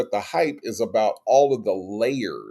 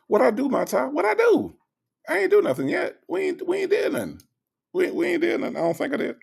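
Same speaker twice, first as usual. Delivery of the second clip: very high, protesting what-did-I-do voice; mock innocence; faster than usual. Answering a viewer's "please, Native" in a very high mock-innocent voice. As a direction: play innocent, pitch way up.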